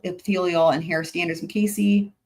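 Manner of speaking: ordinary delivery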